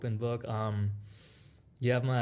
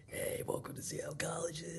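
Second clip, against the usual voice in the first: hoarse, whispery worn-out voice; raspy voice; 7 semitones higher, 9 dB quieter than usual. Retold or imitated. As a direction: imitate a voice worn out from talking. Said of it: "literally raspy voice".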